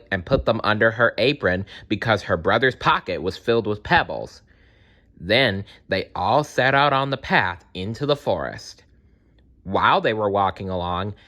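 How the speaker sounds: ordinary delivery, nothing marked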